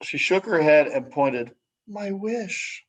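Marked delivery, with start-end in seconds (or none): 1.9-2.9 small tearful voice. Acted. Tearful girl points at crushed dandelion.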